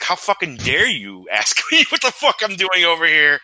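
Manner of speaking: replaying his own outraged comeback to the cops, with a laugh breaking in; retold outrage. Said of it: "like, outraged about it".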